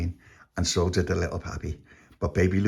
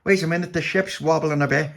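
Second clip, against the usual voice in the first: lightly voiced, higher captain; 7 semitones higher than usual. A narrator's reading. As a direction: raise the voice a little for the captain. Captain notices the ship wobbling.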